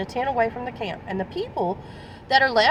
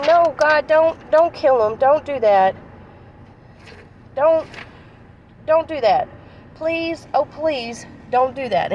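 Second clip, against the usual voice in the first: higher, louder pleading; pleading Moses; 3 semitones higher, 5 dB louder than usual. Retold or imitated. Moses begging God on the mountain, loud pleading. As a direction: beg God desperately not to do it.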